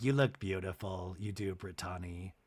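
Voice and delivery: low, soft comforting voice; imitated gentle reassurance. Brooks comforting Brittany, low and softly reassuring imitation. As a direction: console her very quietly, as an impression. Retold consoling of upset Brittany.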